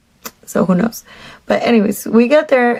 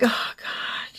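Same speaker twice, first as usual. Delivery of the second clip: hushed, voiceless groan of dismay; retold dismay; 11 dB quieter than usual. Mother hearing her son want ice cream, muttered dismay. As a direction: drop to a near whisper, as if to yourself.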